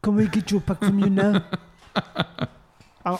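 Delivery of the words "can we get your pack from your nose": put-on thick Afrikaans accent English. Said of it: "asked the guy three times".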